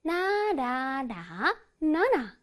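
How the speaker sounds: "나라라, 나나" as drawn-out notes; sing-song syllables modelling a sentence tune; slow, melodic "나라라, 나나"; piano-like tune. Shown as a demonstration. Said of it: "내 몸이 피아노가 된다고 생각해야 돼".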